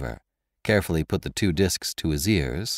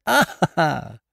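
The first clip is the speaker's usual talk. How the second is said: loud, high triumphant laugh; shouting with laughter; 9 semitones higher, 3 dB louder than usual. Acted. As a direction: burst out laughing at the trap. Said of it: "shouting with laughter".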